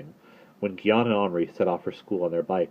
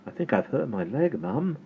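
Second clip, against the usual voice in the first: pitifully; 6 semitones higher, 5 dB quieter than usual. Acted. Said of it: "he said pitifully".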